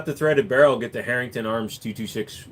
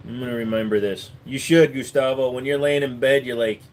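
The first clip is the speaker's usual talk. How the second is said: ordinary talk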